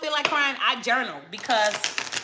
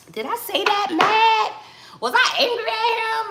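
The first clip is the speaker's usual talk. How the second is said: anxious disbelief; 10 semitones higher than usual. Retold or imitated. Asking Spirit in disbelief.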